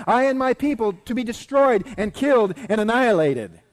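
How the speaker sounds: loud, high-pitched denunciation; crying out. Acted. Queen crying out that her people are doomed, loud and high. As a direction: cry out each fate forcefully. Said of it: "she cried".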